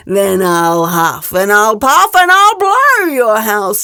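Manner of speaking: slow, loud, drawn-out wolf threat; big bad wolf. Wolf threatens the little pig, slow and loud. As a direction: menace the pig slowly.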